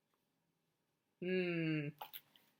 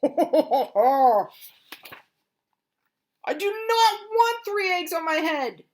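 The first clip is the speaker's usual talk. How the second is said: much higher, louder refusal; upset protest; 10 semitones higher, 10 dB louder than usual. Acted. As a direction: stamp your foot in protest. Elephant protests the eggs.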